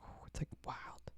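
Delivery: unvoiced searching whisper. Real, not acted. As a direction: whisper it to yourself. Whispering while searching a song.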